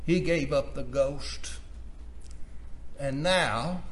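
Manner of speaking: ordinary delivery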